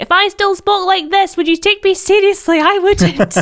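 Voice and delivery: put-on high unbroken child voice; childlike high voice. Shown as a demonstration. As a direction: pitch it way up like a kid. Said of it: "But then I have to remind them".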